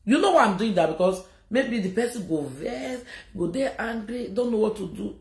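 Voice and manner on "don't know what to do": normal talking voice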